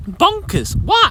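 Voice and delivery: high, disbelieving what; incredulous what. Real, not acted. Farmer reacting to the inheritance tax, stunned disbelief.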